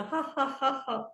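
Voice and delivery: soft ha ha into giggle; laughing. Real, not acted. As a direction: let it dissolve into giggles. Laughter yoga session.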